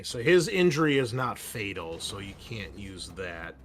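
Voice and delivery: ordinary talk, nothing marked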